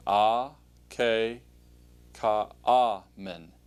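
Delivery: "A, K, Ka, A, Men" split into separate syllables with pauses; syllable-by-syllable pronunciation. Shown as a demonstration. Teacher models a Greek word to repeat, syllable by syllable. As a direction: sound out "A, K, Ka, A, Men" slowly, falling on "Men". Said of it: "pronounce the word, and then you can say it right after me".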